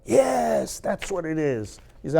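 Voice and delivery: ordinary delivery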